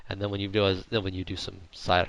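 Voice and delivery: ordinary delivery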